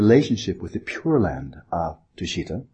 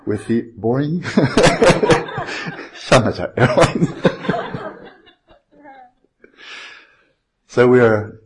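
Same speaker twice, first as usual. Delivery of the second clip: pilot announcement, cracking up; giggling through the captain's cabin speech; laughing captain announcement; 5 semitones higher, 6 dB louder than usual. Pretend. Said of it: "I'm really enjoying this".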